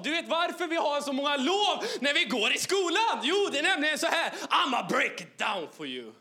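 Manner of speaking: high, loud, fervent preacher voice, retold; hammed-up revival preaching to a schoolboy; preaching at him. Retelling preaching to a pupil. (överdriven väckelsepredikan)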